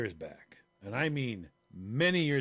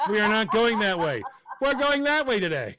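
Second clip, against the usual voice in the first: loud, high, laughing order; mock scolding, louder and higher; bossy shout; 7 semitones higher, 9 dB louder than usual. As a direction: bark it at him like a bossy old lady. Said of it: "told him".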